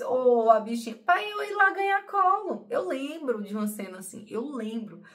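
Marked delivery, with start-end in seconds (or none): 0.0-1.0 put-on loud cooing over a hurt child; mock loving mom cooing; cooing mother. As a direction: coo like a mother comforting her little one. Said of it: "Eu queria que a minha mãe fizesse".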